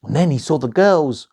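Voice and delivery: ordinary delivery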